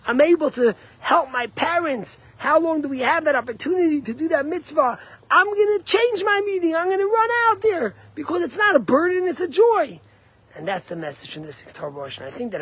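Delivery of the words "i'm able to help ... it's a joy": high, loud, joyful resolve; bursting with the joy of a mitzvah; happy eagerness. Retold or imitated. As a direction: rise into eager delight. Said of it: "positive, happy attitude".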